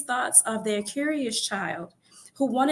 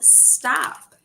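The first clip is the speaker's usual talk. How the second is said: mock yell; 5 semitones higher than usual. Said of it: "her parents yelled".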